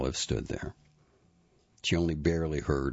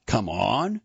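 louder, rising disbelief; loud incredulous challenge; 6 semitones higher, 5 dB louder than usual. A narrator's reading. Chris challenging his mother's remark, loudly incredulous. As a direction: throw it back as a loud question. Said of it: "he said this loudly".

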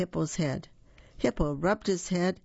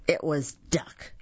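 ordinary delivery

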